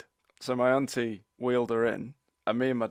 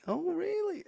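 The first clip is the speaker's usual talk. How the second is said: very high put-on "oh, really"; imitating credulous grandmother; slower than usual. Grandmother answers her teasing cousin, high mock credulity. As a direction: squeak it like an old lady taken in. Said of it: "And she's like".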